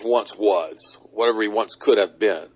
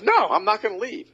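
mock defiant lady. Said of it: "And she goes".

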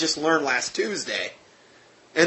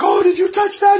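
much higher and louder panicked "Oh, did you touch that?"; freaking out; 7 dB louder than usual. Retold or imitated. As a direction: voice the driver losing it in terror. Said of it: "he was freaking out".

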